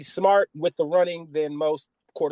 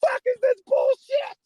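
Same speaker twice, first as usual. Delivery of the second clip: much higher and louder angry yell; furious outburst; 5 dB louder than usual. Retold or imitated. Fan at home raging at the game, shouting. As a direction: blow up at the TV in outrage. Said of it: "I'm mad as hell".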